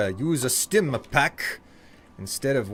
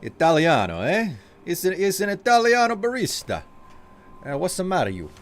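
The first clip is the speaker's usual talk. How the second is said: hammed-up Italian accent, higher and louder; Italian barista accent; 6 semitones higher, 3 dB louder than usual. Streamer putting on an Italian barista accent for chat. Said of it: "Oh, Italian barista".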